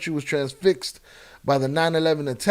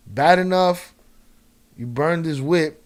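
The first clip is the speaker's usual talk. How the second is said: ordinary talk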